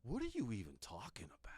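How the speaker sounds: fast hushed whisper; mock-outraged whisper. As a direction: hiss it under your breath. Co-host mock-scolding the host.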